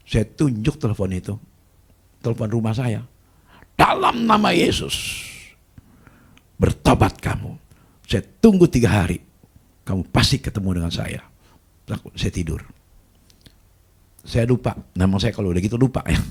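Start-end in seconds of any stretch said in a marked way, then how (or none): 3.7-11.2 commanding rebuke. Retold or imitated. Pastor rebukes caller by prayer.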